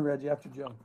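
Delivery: usual speaking voice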